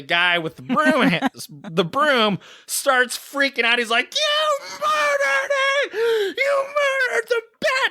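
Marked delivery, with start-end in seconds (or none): 4.1-7.9 very high, slow, tearful wailing shout; sobbing accusation. Retold or imitated. Distraught guy blaming the bat killer, tearful high wail. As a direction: wail it like your heart just broke. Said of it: "he's just in tears".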